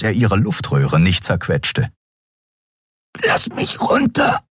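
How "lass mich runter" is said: slow, strangled, voiceless plea; throat squeezed, words barely escaping; choked rasp. A narrator's reading. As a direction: gasp it out while being strangled. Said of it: "röchelte sie".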